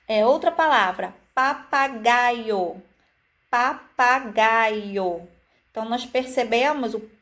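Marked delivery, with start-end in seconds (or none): 1.3-2.8 papagaio said slowly, every syllable marked; slow syllable demo. Shown as a demonstration. Teacher to children, sounding out syllables. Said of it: "enfatizando cada sílaba". 3.5-5.3 Papagaio stretched very slowly; very slow demo. Shown as a demonstration. Teacher stressing the clear pá for children, very slowly. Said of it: "enfatizando cada sílaba".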